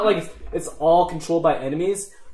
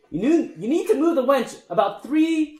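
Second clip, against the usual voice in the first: higher-pitched gnome giving orders; pitched-up gnome foreman; bossy gnome leader; 6 semitones higher than usual. Acted. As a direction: instruct a fellow gnome in a high character voice.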